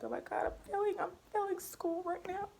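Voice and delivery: venting. Retold or imitated. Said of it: "venting at the table".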